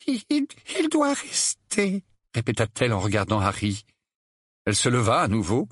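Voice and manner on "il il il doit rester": high, slow, stammering frailty; faint voice. A narrator's reading. Aunt Petunia repeating that Harry must stay, faint voice. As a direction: falter weakly on the first word. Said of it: "d'une voix faible".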